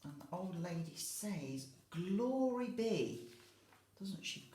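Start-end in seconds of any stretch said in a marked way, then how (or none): 1.9-3.3 slow, drawn-out amazed cry; surprised exclamation. Acted. Teacher voicing the surprised old lady for children.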